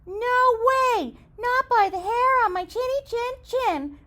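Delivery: high, squeaky little pig; little pig crying out. Acted. Second pig refusing the wolf, high and squeaky. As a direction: cry it high like a small pig. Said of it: "cried the second little pig".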